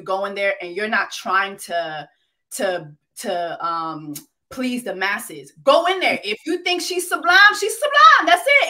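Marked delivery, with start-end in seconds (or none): 5.6-8.7 loud, high, insistent push; emphatic urging. Real, not acted. Host urging the contestant, loud and high. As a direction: fire him up to be himself.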